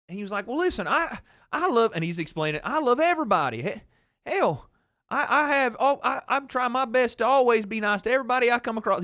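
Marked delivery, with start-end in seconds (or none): 0.5-1.9 high-pitched imitation of dad explaining himself; genuinely upset dad. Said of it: "He was genuinely upset". 2.6-9.0 high-pitched imitation of dad pleading his case; genuinely upset dad. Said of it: "He was genuinely upset".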